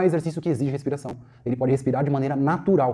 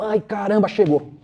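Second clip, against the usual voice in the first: raised, louder groan of disappointment; mock dismay; 5 semitones higher, 3 dB louder than usual. Tired teacher seeing late student arrive, mock dismay. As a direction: exclaim it like your hopes were just dashed.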